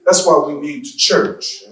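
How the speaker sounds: ordinary delivery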